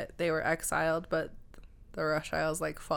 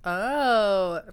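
surprised; 4 semitones higher, 7 dB louder than usual. Real, not acted. Just learned a new word.

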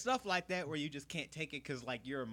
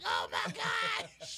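very high, slow, choked mock sob; hammed-up teary gasp; mock weeping; much slower than usual. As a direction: draw it out like you're about to cry. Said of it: "you're just like".

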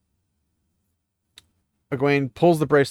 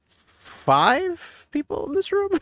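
sarcastic disbelief; slower than usual. Real, not acted. Disbelief at a crowded scene.

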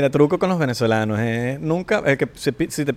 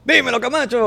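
military name call; 7 semitones higher than usual. Shown as a demonstration. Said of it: "Camacho suena como".

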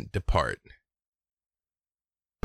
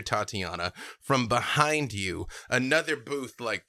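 ordinary talk

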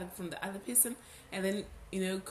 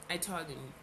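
usual speaking voice

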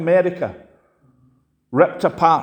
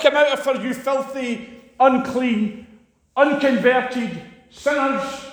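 slow, scornful preacher's rebuke of "filthy, unclean, unconverted sinners"; hammed-up fire-and-brimstone scorn; mock condemnation; 7 semitones higher, slower than usual. Shown as a demonstration. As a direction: sneer the words out like a harsh judge.

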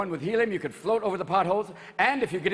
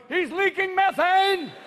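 dog voice; 11 semitones higher, 4 dB louder, slower than usual. Pretend.

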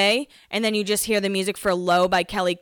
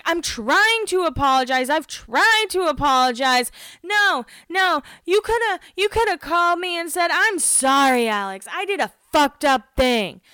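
higher, shouty mock argument; hammed-up heated rant; imitating an angry cast member mid-fight; impression of the fight; 9 semitones higher than usual. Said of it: "That is my impression of this fight".

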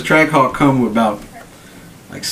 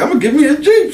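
much higher, laughing through it; laughing quote; 13 semitones higher, 3 dB louder, faster than usual. Retold or imitated. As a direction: giggle out your own old excitement. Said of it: "I was like".

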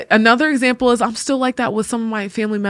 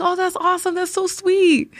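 sweetly humoring; 11 semitones higher than usual. Retold or imitated.

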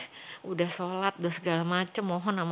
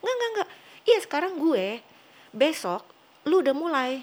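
insisting brother; 8 semitones higher, 3 dB louder than usual. Retold or imitated. Brother insisting in retold dream.

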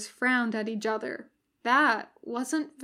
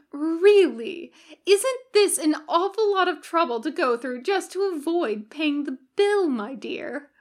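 higher, louder fussy reproach; exasperated angel; 5 semitones higher, 4 dB louder than usual. A narrator's reading. Aziraphale chiding Crowley over the bill, higher and fussy. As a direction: scold like a prim old librarian.

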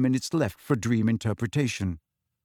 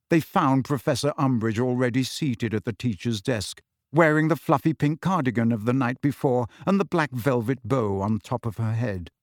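plain reading voice

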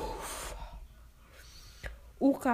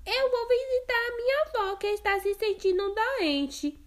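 put-on much higher innocent girl; little girl voice; 12 semitones higher, 3 dB louder than usual. Girl answering the wolf, high and innocent. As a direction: answer the stranger trustingly. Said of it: "respondeu a Chapeuzinho".

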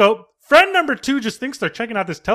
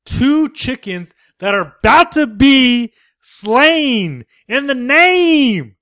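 mock satanic intoning; 3 semitones higher, 5 dB louder, much slower than usual. Describing the chickens for sacrifice, slow hammed-up dark ritual voice. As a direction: intone it like a cult priest.

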